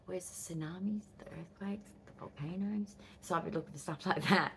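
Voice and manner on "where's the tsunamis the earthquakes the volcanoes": hushed request. Retold or imitated. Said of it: "I'm like".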